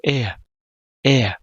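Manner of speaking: Er as "e-a", like two e vowels run together; two vowels in a row. Shown as a demonstration. Teacher shows Er is two e's in a row, vowel ending. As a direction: repeat Er separately, no consonant r.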